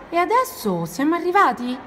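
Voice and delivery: high-pitched, eager asking; hopeful question. Acted. Ranocchio asks after a long climb, hopeful. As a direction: ask it high, hoping for yes.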